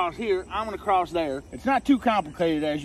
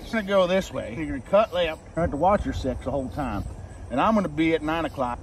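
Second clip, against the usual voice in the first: normal talking voice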